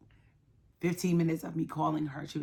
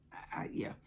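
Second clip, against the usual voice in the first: voice breaking; 10 semitones lower, 8 dB quieter than usual. Real, not acted. Breaks off telling of holding daughter.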